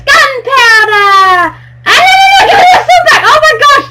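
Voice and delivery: high, loud laughing shout of protest; laughing shriek. Real, not acted. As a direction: shriek through laughter to get the sword back. Prank gone wrong, friend takes sword.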